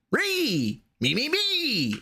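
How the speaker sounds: mock self-centred squeal. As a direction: ham up the egotism, ending very high. Reads a comment mocking egotism.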